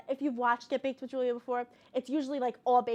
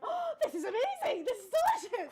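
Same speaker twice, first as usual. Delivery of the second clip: high, laughing mock rapture; imitated kids' delight; 12 semitones higher than usual. Girls tasting toasted waffles for the first time, high and gushing. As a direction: gush like a kid tasting something new.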